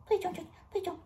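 high whispered plea as the dog. Pretend. Owner voices dog Ming begging not to go to the groomer, whispered plea. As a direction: beg softly in the dog's voice.

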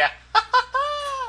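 squeaky, high burst of laughter. Real, not acted. Amused at his own wail, high laugh. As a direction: crack up at your own bit.

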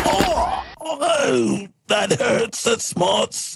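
high-pitched cartoon moan of pain; wounded villain. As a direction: groan it out like a shot cartoon baddie. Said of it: "shoots light bulb and goes".

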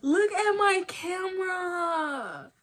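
high, slow, crying with joy; almost weeping over it; tearful excitement. Real, not acted. Showing viewers her camera, tearful joy. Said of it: "I'm so excited".

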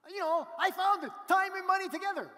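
imitating an overexcited friend insisting; ecstatic insistence. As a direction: shout it like the discovery of a lifetime. Friend insists yet again.

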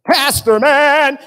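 loud, hammed-up hail of pastor man; mock loud shout of pastor man; mock admiring call. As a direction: belt it like a fan greeting a hero. People hailing the pastor as superhero.

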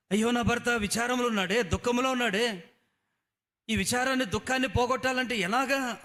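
high, anxious fretting; high worried sigh of a caring wife. Acted. Wife frets over sad husband.

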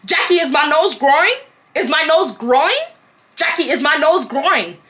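high, singled-out question to a friend; childish mock questioning. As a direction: ask it in mock innocence, insistently. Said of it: "I am childish".

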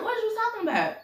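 very high, baffled protest; incredulous daughter. Acted. Daughter answers her accusing father, incredulous. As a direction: question it in high-pitched disbelief.

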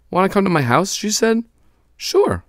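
put-on young girl voice. Children talking, voiced high.

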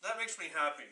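chewing while talking; speaking around cookie bite; mouth partly full. Real, not acted. Seen 0: Left man bit cookie at -4s, holds piece while talking.

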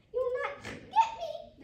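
high, loud, drawn-out squeal; shrieky little pig taunt; piggy cry. Acted. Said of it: "the piggy cried".

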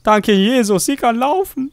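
high loud hallelujah praise; mock preacher. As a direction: shout it like a revival preacher. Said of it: "das ist ein Wunder".

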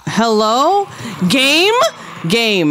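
sudden high alarmed calls; rising alarmed questions at the game; startled. Real, not acted.

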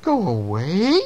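questioning echo, sharply rising at the end; puzzled cricket. A narrator's reading. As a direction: repeat it back as a surprised question. Cricket echoes the mouse, questioning.

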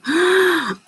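sharp high gasp of recognition; surprised gasp. Real, not acted. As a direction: gasp as a name clicks. Recognises an old viewer's comment.

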